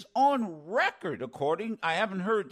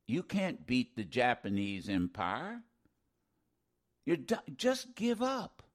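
mock Tokyo Rose; 8 semitones lower, 6 dB quieter than usual. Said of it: "she would go on radio".

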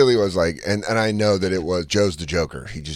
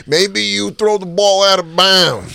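put-on slow Italian accent, higher and louder; 8 semitones higher, 5 dB louder than usual. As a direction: drawl it like an Italian coach. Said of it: "my Chris Italian impression".

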